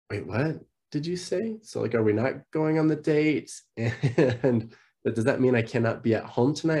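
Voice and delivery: retold high-pitched confused questioning; stunned, piecing it together in rising questions; bewildered disbelief. Husband on park bench asking wife about the date, bewildered.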